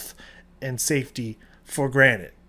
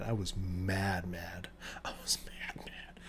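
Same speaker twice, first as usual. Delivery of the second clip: fading to whisper; 6 semitones lower, 14 dB quieter, slower than usual. Real, not acted. Venting anger, then fading.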